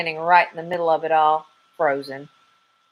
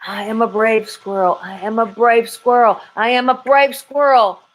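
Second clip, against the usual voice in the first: from steady to higher and louder; mantra building to confident; 5 semitones higher, 4 dB louder than usual. Acted. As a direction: gain courage with each repeat. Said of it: "Repeat after me".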